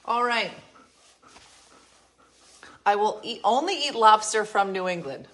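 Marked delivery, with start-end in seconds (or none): 2.8-5.3 loud, high mock proclamation; mock haughty declaration. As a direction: proclaim it grandly. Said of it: "imitating Di's food rule".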